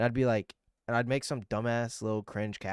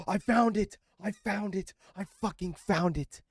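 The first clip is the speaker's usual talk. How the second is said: gasp, then high, thrilled shouts; high, elated, breathless I found it; real triumphant excitement; 9 semitones higher than usual. Real, not acted. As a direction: burst out with joy at finally finding it. Finally finds the lost video.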